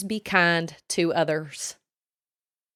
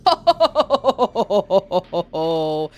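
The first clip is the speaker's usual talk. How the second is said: long run of quick "ho" pulses sliding down; loud laugh in a steady rattle; rapid staccato laugh; 5 dB louder than usual. Real, not acted. Own long laugh after talk.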